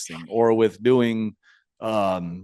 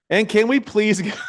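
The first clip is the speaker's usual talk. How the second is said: higher-pitched, breaking up mid-question; real laugh; 7 semitones higher, slower than usual. Real, not acted. Host cracks up reading a listener's question, really laughing. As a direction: fight through real laughter to get it out. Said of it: "see, that's a real laugh".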